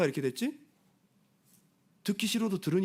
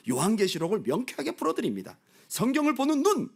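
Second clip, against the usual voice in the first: mock sales pitch; 5 semitones higher than usual. Imitating cult phone pitch.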